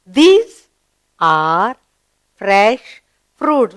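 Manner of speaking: word by word with clear gaps; read-along model. Shown as a demonstration. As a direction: lead the class through each word separately. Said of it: "Read again".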